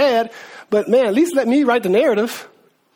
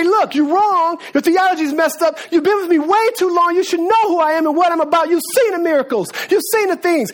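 higher-pitched, relentless scolding; browbeating; 6 semitones higher than usual. Acted. Preacher acts out Jesus chiding a grieving woman, browbeating. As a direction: lay into her, point after point. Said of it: "just browbeat her and say".

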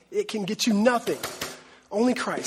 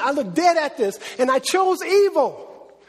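preaching at full force; 9 semitones higher, slower than usual. Acted. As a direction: proclaim it to the whole church, slow and high. Opening the sermon at full force.

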